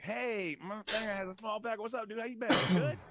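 high, mock-friendly hail to an old rival; taunting greeting. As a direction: play a gleefully cheery public taunt. Imagined taunting greeting at reunion.

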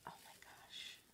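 breathless whispered oh my gosh; whispered awe. Real, not acted. Crafter peeling a transfer on her live, hushed whisper.

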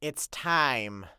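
low, slow, foreboding voice; ominous announcement. Acted. Fluttershy announcing that the war starts tomorrow, ominous and low. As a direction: let the dread sink in.

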